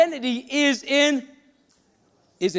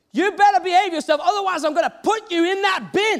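raised, high-pitched scolding threat; angry lady; 6 semitones higher, 4 dB louder than usual. Retold or imitated. Angry lady threatening her child outside the house, furious. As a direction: scold hard, loud and high, like a furious parent. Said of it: "I saw this angry lady".

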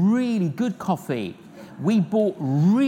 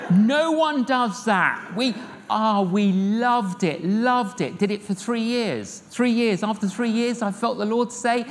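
normal talking voice

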